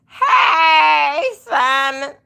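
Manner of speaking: loud high call to a chat member. Real, not acted. Streamer calling out to a viewer in chat, loud. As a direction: hail someone across the room.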